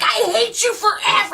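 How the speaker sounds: high, drawn-out mock rage; mock angry yell. Friend retelling a belligerent fight, hammed-up high-pitched anger. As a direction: squeal it slowly, half laughing.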